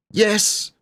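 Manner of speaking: high, carried call; distant shout. Acted. Children calling down from the tower. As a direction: shout from far off. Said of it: "shouted four faraway voices".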